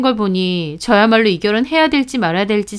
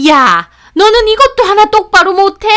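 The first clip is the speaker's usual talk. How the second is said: high, loud scolding shriek; shrieking yell; 11 semitones higher, 7 dB louder than usual. Acted. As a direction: scream it as the furious mother-in-law. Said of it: "소리를 빽 지르시는 겁니다".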